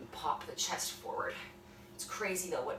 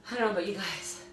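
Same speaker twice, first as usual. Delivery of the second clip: ordinary talk, nothing marked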